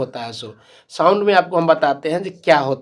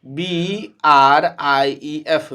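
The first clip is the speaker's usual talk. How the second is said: slow, deliberate letter-by-letter spelling for the class; teacher spelling a word slowly to students; much slower than usual. Shown as a demonstration. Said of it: "देखिए ई का उच्चारण आ रहा है".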